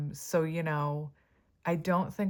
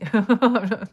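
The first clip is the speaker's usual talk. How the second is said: loud burst of laughter; real laughing fit; 5 semitones higher, 13 dB louder than usual. Real, not acted. Reader cracking up at their own sarcasm, loud real laugh. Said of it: "I don't know why I'm laughing".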